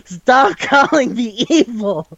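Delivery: very high, drawn-out whine of protest; mock exasperated protest. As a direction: imitate an indignant hero, high and slow. Retold hero protests being called evil.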